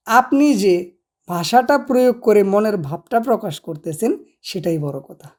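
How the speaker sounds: normal talking voice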